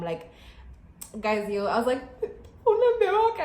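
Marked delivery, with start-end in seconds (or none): 2.7-3.4 high, wailing mock sob; mock crying. Vlogger re-enacting crying in the car, mock crying. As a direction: wail it in a high imitated cry. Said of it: "and I'm crying".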